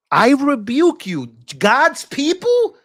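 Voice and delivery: high, slow hammed-up rebuke; mock preacher rebuke. Mocking pro-Israel critics with a hammed-up preacher's rebuke.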